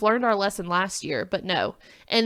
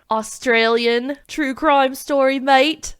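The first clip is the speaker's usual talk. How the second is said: louder, hammed-up Australian accent; bad Australian accent; 4 semitones higher, 7 dB louder, slower than usual. Host teasing her co-host's Australian story pick, bad Australian accent. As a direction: have a poor go at an Aussie accent. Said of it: "I cannot do an Australian accent".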